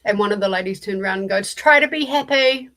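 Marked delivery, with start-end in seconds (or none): 1.5-2.7 high, loud sing-song preachy chirp; mock chirpy. Retelling the door knocker's parting line, mock chirpy. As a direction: imitate her sugary parting line.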